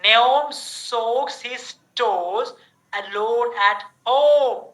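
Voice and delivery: very slow, each word spelled out; slow drill reading. Shown as a demonstration. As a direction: read it slowly, stretching the O sound. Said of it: "First, O sound".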